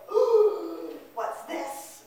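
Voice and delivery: loud, high drawn-out "ooh"; acted parental alarm. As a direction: react to an unwelcome discovery. Parent discovers the bad quiz.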